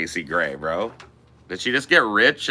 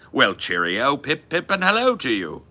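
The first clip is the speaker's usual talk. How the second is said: hammed-up posh British English, higher and chirpy; mock British accent; 5 semitones higher than usual. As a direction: play a jolly old Londoner for laughs. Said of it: "I'm just stereotyping the fuck out of you".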